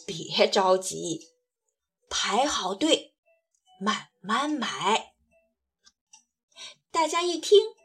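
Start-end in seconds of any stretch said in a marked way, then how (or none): 0.1-5.1 put-on low, slow kindly old man; gently calm the crowd, low and slow; grandpa voice. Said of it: "老爷爷笑呵呵地说". (低沉缓慢的老爷爷声音)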